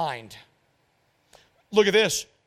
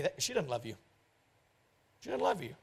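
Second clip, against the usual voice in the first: quiet warning; 6 semitones lower, 8 dB quieter, faster than usual. Real, not acted.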